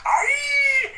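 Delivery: high, loud little creature yell; Ewok war cry. Acted. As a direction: shriek like a tiny charging warrior. Second Ewok charges.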